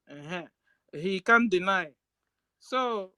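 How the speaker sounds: normal talking voice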